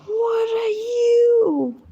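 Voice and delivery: very high, long-held wail of bafflement; long, high "you" sliding down at the end; high drawn-out question. Real, not acted. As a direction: stretch it out high and slow. Puzzled again, drawn out.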